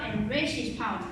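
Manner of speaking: usual speaking voice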